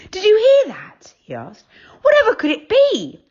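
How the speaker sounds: high, startled cry; jolted awake by a screech; startled child. A narrator's reading. Joe voiced.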